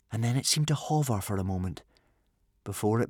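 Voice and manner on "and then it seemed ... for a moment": usual speaking voice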